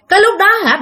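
plain reading voice

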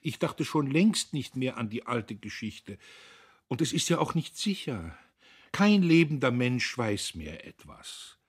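high, wailing protest; whimpering. A narrator's reading. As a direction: whine your innocence pitifully. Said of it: "Wimmernd kam es aus der Tiefe".

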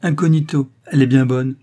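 amused outburst. A narrator's reading. Driver finds Rose's incognito absurd, amused outburst. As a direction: throw the word back as a great joke. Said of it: "Il éclata de rire".

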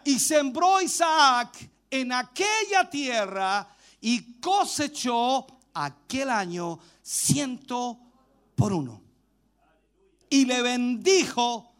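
very slow, spaced-out scripture reading; lectura proclamada. Real, not acted. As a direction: read the verse out phrase by phrase, drawn out. (lectura bíblica solemne, pausada y alargada)

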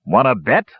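mild roar, a touch tipsy; slurred roar. A narrator's reading. Drunk dwarf challenging the brothers, mildly roaring. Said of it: "roared the dwarf with a slight slur".

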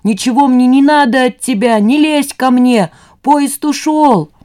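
high, frantic yell, slowing as it climbs; terrible inhuman yell. A narrator's reading. Son rejecting his mother, terrible yelling. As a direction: yell them away, stretching the last words. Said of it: "нечеловеческим голосом он орёт".

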